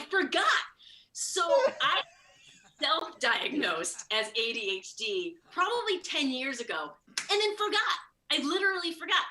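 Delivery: giddy disbelief. Real, not acted. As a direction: still buzzing from the discovery. Laughing at her own forgetfulness.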